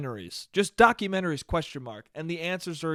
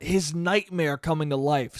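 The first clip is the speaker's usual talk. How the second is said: usual speaking voice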